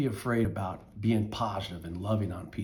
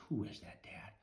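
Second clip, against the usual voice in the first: whisper; 16 dB quieter than usual. Acted. Scared boy asks father.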